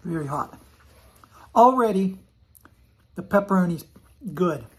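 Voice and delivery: muffled words between chews; talking while chewing. Real, not acted. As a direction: mouth partly full of food. Seen 0: Bit pizza at -9; lips pressed, chewing motions between words.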